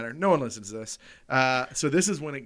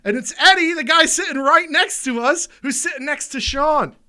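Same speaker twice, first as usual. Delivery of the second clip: much higher and louder, almost shouting; excited disbelief; 10 semitones higher, 12 dB louder than usual. Real, not acted. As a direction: blurt it out in shocked glee. Realizing the guy is right there.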